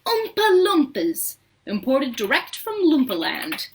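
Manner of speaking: a boastful showman announcing; proudly. A narrator's reading. Wonka proudly naming his workers to the visitors. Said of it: "said Mister Wonka proudly".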